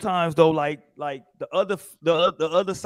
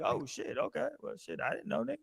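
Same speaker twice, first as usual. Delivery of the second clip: softer, breathy hushed reply; hushed surprise; 5 semitones higher, 15 dB quieter than usual. Retold or imitated. Caught off guard, answering the angry teammate under his breath.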